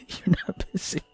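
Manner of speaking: high, voiceless giggly squeak; airy, tickled mockery; giggly repeat. Real, not acted. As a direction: repeat it through suppressed laughter. Reader laughs, repeating the line.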